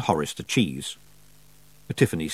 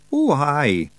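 nervous Feagle; 6 semitones higher than usual. Acted. Feagle voice held.

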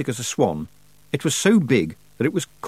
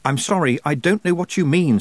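higher, pleading apology; desperate; 6 semitones higher, 3 dB louder than usual. A narrator's reading. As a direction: plead helplessly. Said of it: "said Tiffany desperately".

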